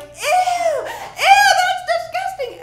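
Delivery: very high, loud squeal of disgust; disgusted little kids. Retold or imitated. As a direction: shriek it like grossed-out children. Nieces reacting to blood.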